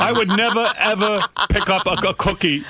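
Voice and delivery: lightly hammed stubborn father, giggling through it; father's voice.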